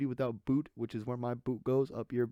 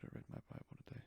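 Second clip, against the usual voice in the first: whispered aside; 15 dB quieter, much faster than usual. Shown as a demonstration. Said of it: "I don't know why I'm acting like I don't".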